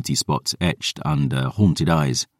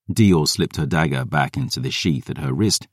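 ordinary delivery, nothing marked

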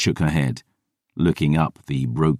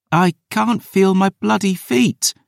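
wailing; 14 semitones higher than usual. A narrator's reading. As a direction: moan it out high and miserable. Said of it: "Dior wailed".

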